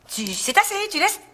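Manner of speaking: high, drawn-out fed-up outburst; exasperated. Acted. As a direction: shut the argument down in put-on Parisian French. Cuts off the accent quarrel.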